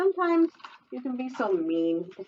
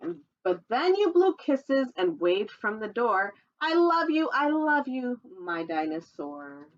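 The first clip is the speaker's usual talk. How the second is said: lively sing-song storybook read, higher; reading to children; 4 semitones higher, slower than usual. Acted. Reading a dinosaur picture book to children, sing-song storytelling voice. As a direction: read the rhyme brightly to little listeners.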